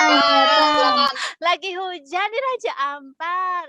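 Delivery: loud, high, thrilled exclamation; excited wow. Real, not acted. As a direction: gasp at the view. Reacting to view of the harbor.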